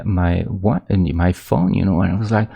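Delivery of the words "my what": normal talking voice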